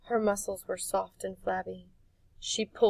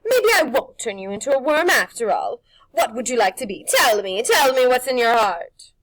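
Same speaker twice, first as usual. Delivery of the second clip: loud, higher wheedling crone; witch voice; 5 semitones higher, 15 dB louder than usual. Acted. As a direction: coax his secret out. Witch coaxing Harvey.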